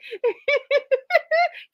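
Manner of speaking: a sudden squeal of a laugh; high burst of laughter. Real, not acted. Host cracking up at a viewer's joke, high squealing laugh.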